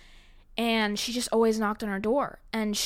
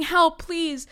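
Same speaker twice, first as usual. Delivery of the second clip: retold scream; 7 semitones higher, 7 dB louder than usual. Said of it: "I'm screaming".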